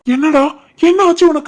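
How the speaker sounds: high-pitched fake alarm; pretend panic. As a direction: frantically question the fainted friend, acting. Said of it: "எழுப்புற மாதிரி பதட்டமா இருடா". (நடிப்பான பதட்டக் குரல்)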